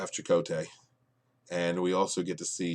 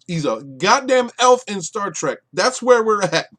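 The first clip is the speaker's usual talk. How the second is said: "elf" yelled much higher and louder; exasperated shout; 10 semitones higher, 11 dB louder than usual. Real, not acted. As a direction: blow up on the repeat, ranting at the screen. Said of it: "'Cause he's a goddamn elf in Star Trek".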